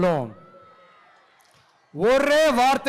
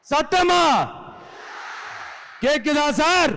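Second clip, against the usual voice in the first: calling out; 4 semitones higher, slower than usual. Real, not acted. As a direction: rouse them, demand an answer. Calling out to rally crowd.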